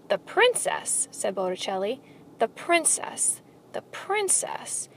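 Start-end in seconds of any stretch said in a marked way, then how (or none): 0.1-1.1 high, slow, drawn-out echo; mock wonder. A narrator's reading. Rat echoes the mouse's quest, in drawn-out mock wonder. 2.4-4.9 high, slow, fading to soft; mock wonder. A narrator's reading. Rat muses on the mouse's princess, slow and high. Rat repeats the word in mockery.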